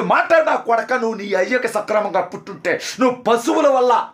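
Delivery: rising shout he then reins in; sudden furious shout, cut off; angry outburst. Real, not acted. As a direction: lose his temper for a moment, then catch himself. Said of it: "కంట్రోల్లోనే మాడదాం".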